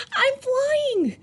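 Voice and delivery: high excited cry of flying. Acted. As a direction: shriek with delight like a happy penguin. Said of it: "said Flip Flop excitedly".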